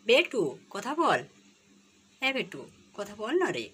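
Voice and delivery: high, loud, slow sing-song coaxing of a pet; loud drawn-out pet coaxing; coaxing pet call. Real, not acted. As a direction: draw it out sweetly to get the bird talking. Coaxing pet bird to talk.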